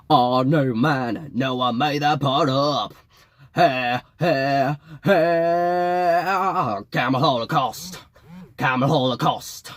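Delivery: hyped rock frontman calling out; excited stage banter. Acted. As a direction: frontman hyping the crowd before the song. John stage banter chant.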